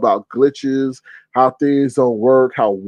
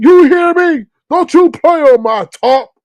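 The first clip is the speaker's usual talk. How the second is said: much higher, loud mock angry yell; imitated yelling; 13 semitones higher, 6 dB louder than usual. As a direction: shout like Lemon, high and loud. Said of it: "all that yelling".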